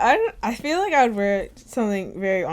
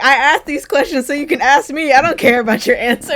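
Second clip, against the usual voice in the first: higher, loud mock exasperation; retold exasperated outburst; 6 semitones higher, 8 dB louder than usual. Retelling a podcast host's comeback, loud mock exasperation. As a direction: push it out in comic indignation.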